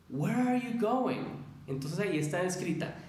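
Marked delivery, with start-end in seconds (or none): 0.1-1.2 "Where are you going?" said slowly and high, words run together; slow English model sentence; slow model phrase. Shown as a demonstration. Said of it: "yo les digo una frase como".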